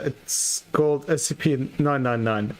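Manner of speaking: ordinary delivery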